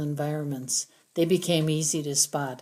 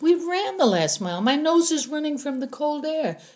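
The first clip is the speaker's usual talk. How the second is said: higher, lightly voiced girl; girl's retort; 11 semitones higher, 4 dB louder than usual. A narrator's reading. Narrator voicing the heroine, a higher girl's tone. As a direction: toss it off as a teen girl. Girl explaining her runny nose.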